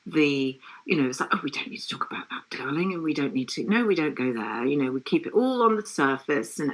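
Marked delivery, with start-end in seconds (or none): 1.3-4.6 whispered, hurried mock parent; hushed imitation of a parent avoiding hard talk; mock family voice. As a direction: breathe it out quickly like hushing the topic. Mimics family avoiding feelings.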